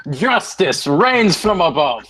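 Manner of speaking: slow, grand voice from the heavens; booming divine voice. Pretend. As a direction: proclaim it like a god.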